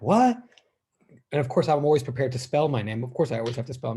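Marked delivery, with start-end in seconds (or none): 0.0-0.4 confused what. Retold or imitated. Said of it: "And they're like".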